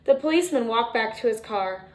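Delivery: plain reading voice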